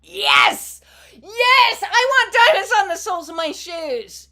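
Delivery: loud, high, thrilled yell; excited shout. Real, not acted. Hearing [name] in her head, shouting with excitement. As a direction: burst out with joy.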